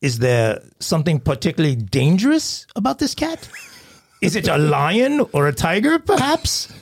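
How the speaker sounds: put-on sarcastic British accent. Dispatcher mocks the caller about lions and tigers, sarcastic British accent. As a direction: sneer at the caller in a mock British voice. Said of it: "how great my British accent is".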